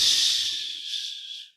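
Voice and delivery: drawn-out, voiceless shush; slow sustained "shhh"; long hushing shh. Acted. God hushing the screaming angels.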